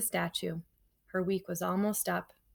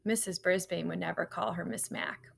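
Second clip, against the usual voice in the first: plain reading voice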